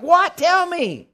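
high, loud eager "What? Tell me"; can't wait to hear it; eager surprise. Retold or imitated. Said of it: "I'm like".

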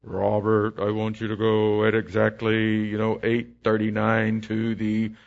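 mock divine command, low and slow; voice of the Lord. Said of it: "the Lord spoke".

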